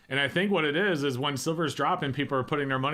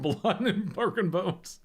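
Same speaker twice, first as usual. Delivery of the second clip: slow, higher voice breaking into laughter; laughing; 6 semitones higher, slower than usual. Real, not acted. Co-host laughing as he recalls the cartoon gag, laughing. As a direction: laugh through the words.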